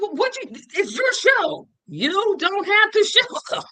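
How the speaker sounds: high-pitched mock exasperation; exasperated retold protest. Host telling Marlon it's his show, exasperated.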